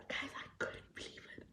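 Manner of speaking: hushed, barely voiced disbelief; soft, breath-only confiding; overwhelmed whisper. Real, not acted. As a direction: drop to a whisper, stunned by it. Overwhelmed after retelling the scream.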